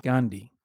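GANDHI dropped low and soft; non-flowing name. Shown as a demonstration. Host contrasting it with Mandela, non-flowing. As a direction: GANDHI falling to a stop. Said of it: "It doesn't flow. I mean, melodically".

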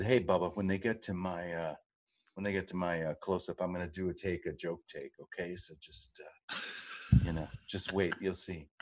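low, hushed, conspiratorial murmur; confiding aside. Retold or imitated. Actor quietly tipping off a co-star about a joke take, confidingly. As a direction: drop it low and soft, like letting a friend in on a prank.

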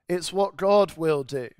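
usual speaking voice

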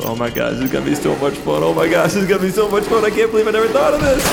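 giddy glee. Acted. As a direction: squeal with mad-scientist delight. Blazin giddy over the bodies.

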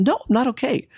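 high-pitched indignant snap; worked-up retold protest; upset retort. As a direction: relive an exasperated outburst, pitch leaping. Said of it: "And I was so upset".

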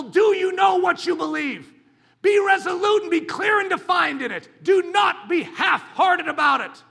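loud, high, rousing sermon; fiery preaching. Acted. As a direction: preach it full force. Preaching at full force.

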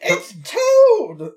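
high, loud squeaky exclamation; high squeaky Toad voice. Shown as a demonstration. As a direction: squeal the name like a tiny mushroom. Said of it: "I mean, sorry".

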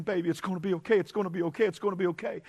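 soft, soothing repeated comfort; gently calm her down, softly; tender reassurance. Pretend. Joseph soothing Mary in labor, soft and tender. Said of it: "loving, rubbing her hair".

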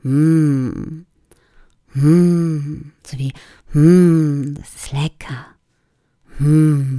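contented humming, as if tasting; mm, delicious; yummy hum; tasty hums. Shown as a demonstration. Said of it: "brumme ein bisschen herum".

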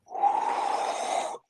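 long unvoiced whoosh of breath; imitated rushing noise. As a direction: blow like wind against a mic. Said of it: "like, it'd be like that".

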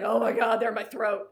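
loud, hammed-up exclamation; exclaiming racer. Random racer admitting nerves to a coach, loud emphatic outburst. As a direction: burst out with it, acting the nervous racer.

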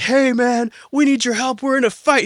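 high-pitched call for help; mock urgent plea. Acting out comrades asking for help.

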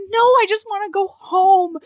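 high, loud imitation of a desperate plea; mock pleading. Heroine answering the man with the knife, high mock-desperate plea. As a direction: wail it as a hammed-up protest.

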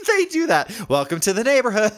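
high-pitched, mocking stranger's voice; imitating a local. Cohost as the local to the punched victim, mock welcome. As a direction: play the neighborhood local in a comic high voice.

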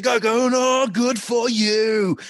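imitated furious rant, high and drawn out; mock an actor going mental on set; high-pitched sarcastic tirade, retold. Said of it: "he's going absolutely mental".

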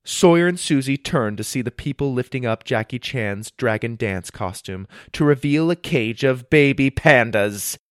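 usual speaking voice

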